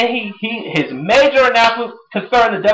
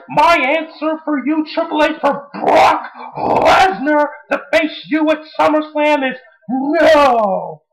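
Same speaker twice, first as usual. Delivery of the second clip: Heyman; 9 semitones higher, slower than usual. Retold or imitated. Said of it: "Heyman's all like".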